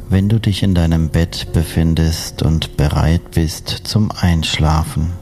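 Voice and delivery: usual speaking voice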